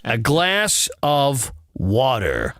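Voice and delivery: slow American "A glass of water"; deliberate put-on American accent. Shown as a demonstration. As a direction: drawl it out in imitated American. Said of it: "do it in an American accent".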